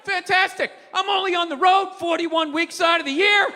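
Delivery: bitter shouted tirade; sarcastic angry rant. Retold or imitated. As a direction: rant like a fed-up husband on the road all year. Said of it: "the old me would have been like".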